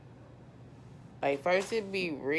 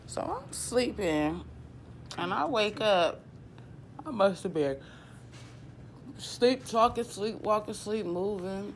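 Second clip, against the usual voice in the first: animated retelling; 7 semitones higher than usual. Retold or imitated. Retelling waking up at night.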